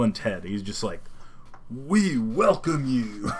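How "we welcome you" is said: very slow, drawn-out dude impression; Keanu Reeves. Fan mimicking an actor in a stoner film, very drawn out. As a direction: stretch every word, slightly raised pitch. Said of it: "Keanu Reeves is just like".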